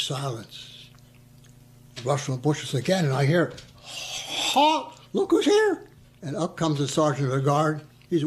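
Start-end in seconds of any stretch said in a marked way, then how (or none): 3.8-5.9 mocking shout. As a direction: yell it back teasingly. Hearing own call echoed.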